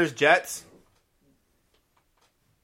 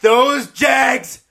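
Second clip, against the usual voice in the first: loud, high, drawn-out hype yell; hype shout; 9 semitones higher, 6 dB louder, much slower than usual. Real, not acted. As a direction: shout the prediction like a fan. Hyping his pick loudly.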